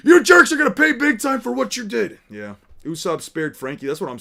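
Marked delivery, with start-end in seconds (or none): 0.1-2.2 louder, higher angry yell; raging Franky. Acted. Boss yelling at crew who wrecked his house, angry. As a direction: shout the threat again. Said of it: "there's that rage problem again".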